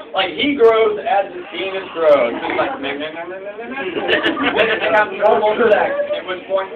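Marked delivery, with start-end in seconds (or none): 2.8-4.5 repeated rising nonsense syllables; mock rising growth noise; imitated growing noise. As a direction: chatter a cartoon sound effect that climbs.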